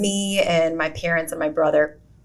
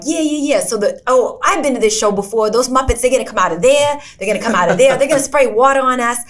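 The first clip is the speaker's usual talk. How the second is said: lightly put-on New Jersey accent, higher; mock New Jersey accent; 5 semitones higher, faster than usual. Joking to his brother in a light New Jersey accent. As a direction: play a Jersey tourist, a hint of New Jersey. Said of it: "talking in, like, New Jersey accents".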